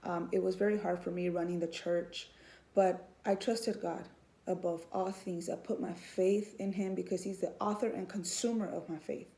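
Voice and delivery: normal talking voice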